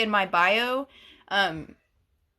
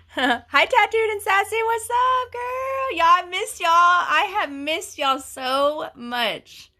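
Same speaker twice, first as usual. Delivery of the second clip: high, loud, drawn-out greeting; excited greeting; 8 semitones higher, 6 dB louder, slower than usual. Real, not acted. Host greeting returning viewers on a live stream, high happy welcome.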